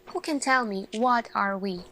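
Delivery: normal talking voice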